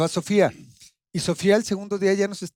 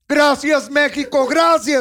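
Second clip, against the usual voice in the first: high, loud mock victory cry; imitated triumphant shout; 8 semitones higher, 5 dB louder, much slower than usual. Imitating Albacete after a lucky win, mock triumphant shout. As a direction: belt it out mimicking a winner. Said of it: "salía y gritaba".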